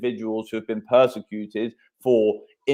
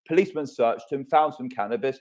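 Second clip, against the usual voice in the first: ordinary delivery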